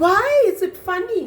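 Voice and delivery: very high, quick question; puzzled baby kangaroo. A narrator's reading. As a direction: ask in a little kangaroo's high voice. Baby Roo questions the laughing bird.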